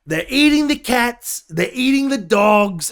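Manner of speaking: high, loud, slow mock Trump; hammed-up Trump impression. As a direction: overdo Trump's claim with big emphasis. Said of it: "Donald Trump says something like".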